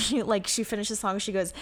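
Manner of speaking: usual speaking voice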